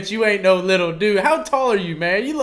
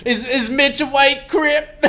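mock astonished; 9 semitones higher, slower than usual. Real, not acted. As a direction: ham up the shock to tease him. Host joking, mock-shocked question.

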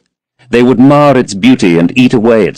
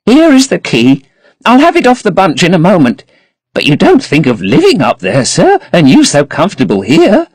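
put-on fussing old housekeeper; 9 semitones higher than usual. A narrator's reading. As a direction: fuss over the bunch of keys. Voices Mrs Leaf fumbling keys.

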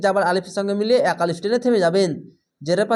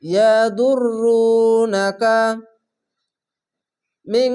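slow drawn-out tajweed chanting; Quran recitation; 3 semitones higher, 4 dB louder, much slower than usual. Shown as a demonstration. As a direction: recite it slowly, stretching the vowels. Recited word.

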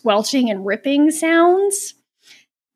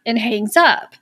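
normal talking voice

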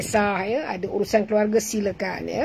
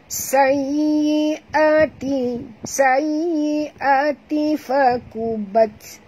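slow, higher chanted recitation; tajwid recitation demo; 4 semitones higher, much slower than usual. Shown as a demonstration. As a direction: model the verse slowly. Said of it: "kena tekan kerana ada tasydid". (bacaan contoh bertajwid perlahan)

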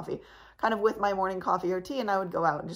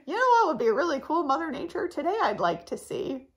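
high, put-on chirpy musing; mock wishful request; 9 semitones higher, 4 dB louder than usual. Vlogger joking she never asked Mother Nature for this, mock-wishful. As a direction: pitch it up in a playful put-on voice.

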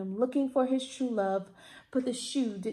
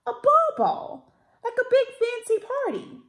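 much higher and louder, insisting; the king exclaims the ball is a party; emphatic king; 11 semitones higher, 5 dB louder than usual. A narrator's reading.

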